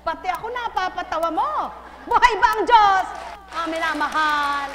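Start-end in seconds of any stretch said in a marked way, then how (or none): 2.1-3.1 preacher call. Pretend.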